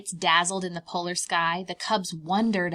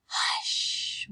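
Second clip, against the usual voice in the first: whispered hush. Acted. Mama Bear soothing her cubs, whispering. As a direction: hush the cub gently. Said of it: "whispered Mama Bear".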